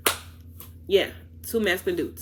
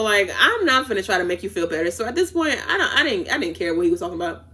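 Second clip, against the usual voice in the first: usual speaking voice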